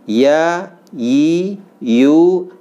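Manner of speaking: teacher repeating ya drill; letter drill. Shown as a demonstration. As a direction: drill each syllable cleanly.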